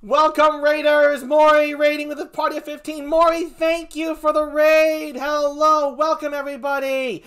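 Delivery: high, drawn-out celebratory call; excited welcome. Real, not acted. Streamer greets raiders, high and exuberant. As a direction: hail the crowd with big energy.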